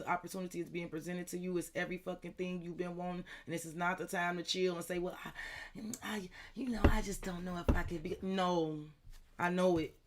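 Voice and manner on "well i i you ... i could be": hesitant, half-whispered stammer; mock someone dithering, voice dropping to a whisper; hesitant excuse. Reader mimics someone too hesitant to take the chance, whispered hesitation. Acting out a reluctant person's excuse.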